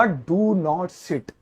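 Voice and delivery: slow emphatic reading of a sign; very slow dictation. Shown as a demonstration. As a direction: dictate "Do not sit" slowly for notes. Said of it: "तो उसके ऊपर मैं लिखूंगा".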